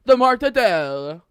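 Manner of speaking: loud, drawn-out, high mock Italian-American accent. Friend mocking Italian Americans, exaggerated Italian-American bellow. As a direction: overdo the Italian deli word slowly. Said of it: "but they're just like".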